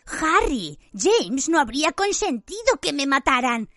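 high, slow pleading whine; begging Pettigrew voice. A narrator's reading. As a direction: beg for mercy in a thin voice. Pettigrew begs for his life.

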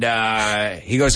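ordinary talk, nothing marked

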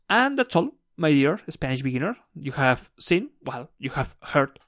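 ordinary talk

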